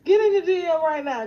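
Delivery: high, loud panicked call; mock panic from the team; urgent alarm. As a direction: sound the alarm, half crying. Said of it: "My team be like".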